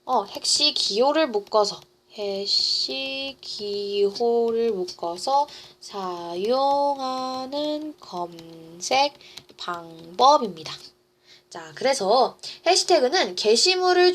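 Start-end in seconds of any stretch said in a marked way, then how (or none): 2.2-10.7 very slow, stretched syllables; drawn-out write-along delivery; slow dictation. Real, not acted. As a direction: spell it out at writing speed. Repeats slowly while writing it out.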